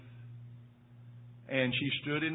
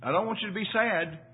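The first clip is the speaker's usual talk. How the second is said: a hint of his wife, higher and gentle with a laugh; dying wife voiced; 6 semitones higher, 6 dB louder than usual. Husband quoting his dying wife, lightly voiced higher. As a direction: lightly play her calm joy. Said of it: "And she said".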